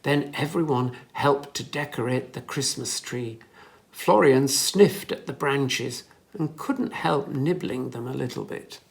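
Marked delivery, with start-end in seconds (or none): none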